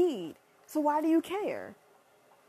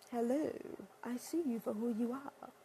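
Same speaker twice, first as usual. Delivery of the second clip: soft, knowing callout; low knowing hello; knowing hush; 3 semitones lower, 10 dB quieter than usual. Real, not acted. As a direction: drop low and quiet like you see through them. Knowing confrontational aside.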